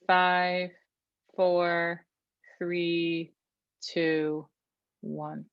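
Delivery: calm, measured counting voice; relaxing breath coach; guided breathing count. Shown as a demonstration. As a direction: count them through each breath steadily. Leads breathing count again.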